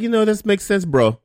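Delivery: put-on a terrible Armenian accent. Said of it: "That's a very terrible Armenian accent".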